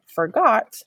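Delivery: forgot, leaning into the got; short for, stressed got in forgot; stress on second syllable. Shown as a demonstration. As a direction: say forgot with a slightly longer got. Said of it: "G O T on the end of that word is slightly longer".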